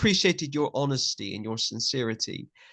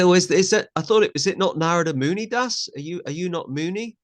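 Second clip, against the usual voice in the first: higher-pitched mock confusion, loud at first; puzzled questioner; 6 semitones higher, 5 dB louder than usual. Devotees querying his initiated name, acted puzzlement. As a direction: imitate someone hesitantly querying a name. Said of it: "And they say".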